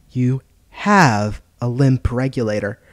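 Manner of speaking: slow, deliberate stress on "have"; emphatic repeat. Acted. Deziro repeats the taunt to Maybach, leaning slowly on "have". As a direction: draw out "have" in "You have".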